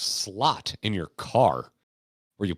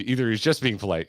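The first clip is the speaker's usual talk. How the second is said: normal talking voice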